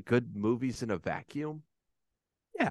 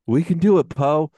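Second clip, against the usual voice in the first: loud, higher rallying call; Lando Calrissian; 4 semitones higher, 8 dB louder than usual. Retold or imitated. Old ally rallies the pilot mid-battle, loud and rousing. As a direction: call out encouragement to a despairing pilot. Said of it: "Billy Dee Williams, good old Lando Calrissian, he goes".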